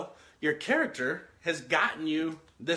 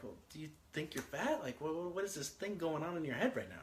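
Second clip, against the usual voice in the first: gentle parent question; 9 dB quieter than usual. Retold or imitated. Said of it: "I didn't say it like that".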